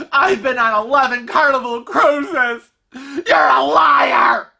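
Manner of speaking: high-pitched, outraged screechy rant; mock screaming. Stranger defending Carnival to husband, screaming. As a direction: imitate a hysterical fan defending her favourite. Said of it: "she starts screaming".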